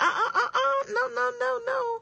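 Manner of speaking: very high, whiny mock crying "no, no, no"; mock whimpering refusal. Coach mocking a self-pitying refusal, high and whiny. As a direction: whine it like a pouting child. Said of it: "Start thinking like".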